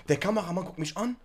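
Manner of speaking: ordinary talk, nothing marked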